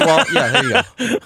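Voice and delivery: laughing. Real, not acted. Laughing at the pronunciation.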